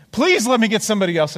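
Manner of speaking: high, loud, hurried plea; mock scared pleading. Scared missionary begging for someone senior, mock pleading. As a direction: imitate a panicked man begging out. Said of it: "he was really scared".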